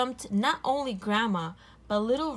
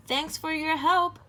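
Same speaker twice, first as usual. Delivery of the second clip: put-on high, bright-eyed little girl; a little girl thanking sweetly; 8 semitones higher, 4 dB louder than usual. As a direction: chirp it like a young girl.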